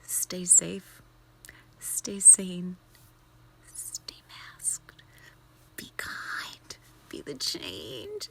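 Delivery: soft, unvoiced farewell whisper; hushed closing mantra; sign-off whisper. Real, not acted. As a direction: whisper the blessings gently. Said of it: "my little pink corner".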